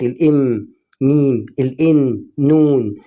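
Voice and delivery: each English letter slowed and set beside its Arabic twin, ال M then ميم; teacher slowly matching ال M to ميم and ال N to نون; slow letter demo. Shown as a demonstration. Teacher to beginners, drawing out ال M and ميم slowly. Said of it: "نفس الصوت".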